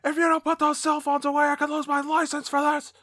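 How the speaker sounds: high, strained imitation of an angry projectionist; mock yell. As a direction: yell in a put-on high voice without real volume. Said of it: "the guy yells at us".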